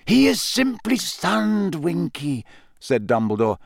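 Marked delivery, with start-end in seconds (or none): 0.1-2.4 slow, higher-pitched measured voice; composed wizard headmaster. A narrator's reading. Headmaster to the shrieking house elf, slow and composed. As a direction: calmly set the frantic one straight. Dumbledore reassures the frantic elf.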